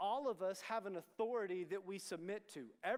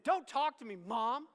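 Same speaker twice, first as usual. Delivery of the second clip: put-on loud, higher-pitched teenage outburst at mom; retold yell; 5 semitones higher, 9 dB louder, slower than usual. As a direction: snap back loudly, acting out an angry son. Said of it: "I'm yelling at my mom".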